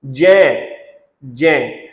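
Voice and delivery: जय with a j sound, set apart; correct pronunciation. Shown as a demonstration. Teacher drilling the right sound for students, clear j. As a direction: say जय on j, never hard g. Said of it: "इसका उच्चारण क्या करेंगे?".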